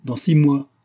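angry vow. A narrator's reading. Marianne vows to Vera after being contradicted, angry vow. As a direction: throw it down as a challenge. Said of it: "Elle fit un geste de colère".

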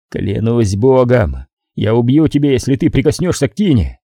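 plain reading voice

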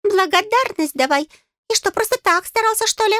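high, insistent little voice; pushy, piping fairy; demanding fairy. A narrator's reading. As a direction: demand your due in a squeak.

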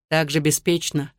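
ordinary delivery

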